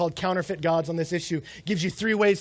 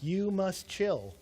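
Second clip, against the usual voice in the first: very slow, softer, spaced-out words; slow, deliberate calming; 7 dB quieter, much slower than usual. Acted. To a furious friend picking a fight, slow and deliberate. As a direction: draw out each word to settle him down. Retold reply to an angry friend.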